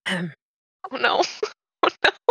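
giggling oh no; laughing. Real, not acted. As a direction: laugh it out, caught off guard.

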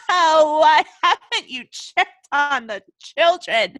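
slow, high, cackling madman voice; maniacal caller. A narrator's reading. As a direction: drag out the question between mad laughs. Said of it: "Maniacal laughter. Then the same voice asked".

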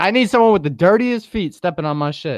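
mock someone's weird demand; lightly voiced snob. Mocking wine lover.

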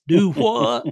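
loud Carolina do what; Southern do what. Retold or imitated. Monk puzzled by his friend's remark, loud Southern do what. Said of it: "guys down that part of the country talk like that".

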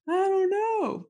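high, wailing little voice, mock-tearful; whiny crying child. Answering mom through mock tears, whiny and high. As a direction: play the sobbing kid who can't explain.

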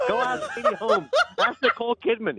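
high squeaky laugh through the words; laughing while mocking. Real, not acted. Podcast host mocks Tom Cruise, laughing high.

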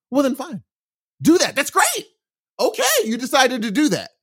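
high mock-approving fine; breezy high sarcastic okay; sarcastic dismissal. Real, not acted. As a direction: shrug it off with a mocking lilt.

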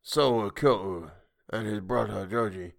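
a hint of a creature's cold anger; lightly voiced, coldly angry creature. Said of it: "cold anger in its voice".

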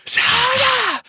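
strained, high yell whisper; pressed voice. Shown as a demonstration. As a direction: squeeze the throat and yell. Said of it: "You're straining your throat".

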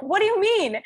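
high, incredulous retold question; baffled. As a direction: jump way up in disbelief. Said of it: "I'm like".

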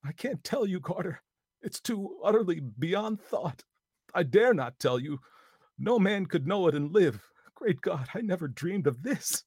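high, loud despairing terror; hoarse with fear. A narrator's reading. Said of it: "hoarse with fear and now apparently tinged with despair".

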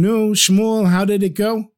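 wife greeting her husband on his return; wife asking. Acted. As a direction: ask like a curious wife. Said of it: "his wife would say to him".

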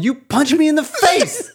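high indignant cry cracking into laughter; laughing mock yell. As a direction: wail it out through giggles. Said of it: "And I just yelled".

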